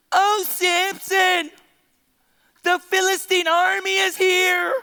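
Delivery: slow, drawn-out mock alarm; Delilah calling out. Preacher plays Delilah waking Samson, drawn-out call. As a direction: stretch it into a hammed-up call.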